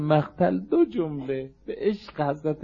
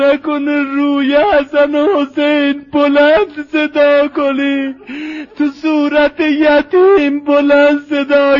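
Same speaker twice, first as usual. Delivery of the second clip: loud, high, drawn-out rawzeh climax; wailing lament; 6 semitones higher, 10 dB louder, slower than usual. Acted. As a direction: cry out the tragedy to stir mourners to weep. Climax of mourning recitation.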